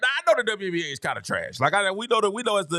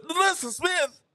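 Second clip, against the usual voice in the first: incredulous; 7 semitones higher, slower than usual. Real, not acted. Disbelief at a pick.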